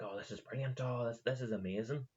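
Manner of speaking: mock gushing board members, mildly impressed; gushing praise. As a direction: imitate an impressed fan. Acting board members gushing over new book.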